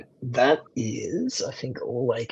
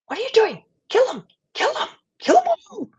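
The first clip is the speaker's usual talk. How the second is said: very high, shrieking villain urging on a kill; piercing falsetto shriek, louder on each order; shrill, high-pitched voice; 6 dB louder, faster than usual. Acted. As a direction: screech it out shrilly from within, loud and frantic. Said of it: "this shrill, high-pitched voice from the inside going".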